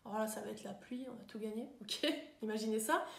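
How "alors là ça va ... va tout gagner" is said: mope quietly through a list of worries; gloomy grumbling. Acted. Thinking of uncool things in lockdown, gloomy. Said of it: "penser à des trucs pas cool".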